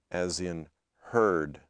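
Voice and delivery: heard with a slow r-colored er; slow r-colored demo; r-colored vowel. Shown as a demonstration. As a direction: curl the tongue through heard. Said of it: "what is called R coloring".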